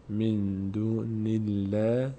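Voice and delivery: slow من دون الله with thin "læ"; light lam. Shown as a demonstration. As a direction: stretch الله on a light front vowel. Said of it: "پر خونده نمیشه".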